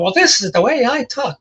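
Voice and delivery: high-pitched, swooping indignant retort; mock freak-out. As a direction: imitate someone protesting hotly, pitch jumping up. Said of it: "freaked out".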